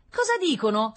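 put-on high, loud child's yell; shrieked question. A narrator's reading. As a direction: call out shrilly from another room. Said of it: "strillò Rita dal bagno".

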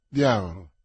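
a low, loud, heavy "Diavolo" on a falling tune; exasperated curse. Acted. D'Artagnan to his servant after a no, a low loud curse. Master cursing each no.

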